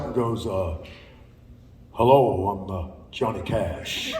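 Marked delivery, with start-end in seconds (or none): 1.9-4.1 deep [name] impression. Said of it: "Ah, no, actually, he didn't do that".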